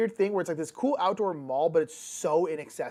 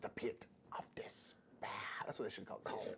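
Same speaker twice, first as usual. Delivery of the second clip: despairing whisper; 9 semitones lower, 17 dB quieter than usual. Pretend. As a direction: whisper it like doom.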